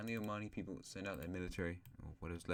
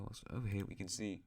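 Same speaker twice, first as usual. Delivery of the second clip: normal talking voice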